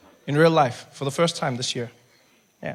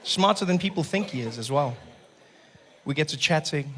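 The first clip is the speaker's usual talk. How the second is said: ordinary delivery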